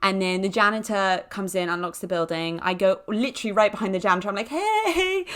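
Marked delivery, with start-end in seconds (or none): 4.5-5.2 high, drawn-out "hey"; eager mock greeting. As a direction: pop up with a long, high hello. Greeting janitor from right behind.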